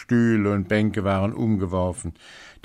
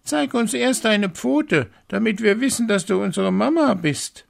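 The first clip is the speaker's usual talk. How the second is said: put-on high, loud kids' voice, much higher; goat kids calling; 5 dB louder than usual. A narrator's reading. Little goats refusing the wolf, high and loud. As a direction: shout it like defiant little kids. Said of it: "Und die Geißlein riefen".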